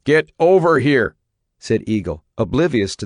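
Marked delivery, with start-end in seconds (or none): 0.0-1.1 loud, higher, hard order; angry command. A narrator's reading. Father ordering his defiant son over, angry loud command. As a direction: bark the order at the boy.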